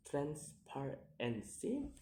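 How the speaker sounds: "transparency" slowly split into syllables; slow, separated syllables on "transparency". Shown as a demonstration. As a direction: sound out "transparency" piece by piece. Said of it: "count the word syllable backwards".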